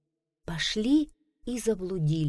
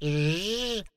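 bee buzz. Acted. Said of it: "говорит пчела".

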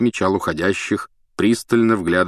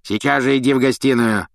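commanding Filch; 5 semitones higher than usual. A narrator's reading. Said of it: "скомандовал Филч".

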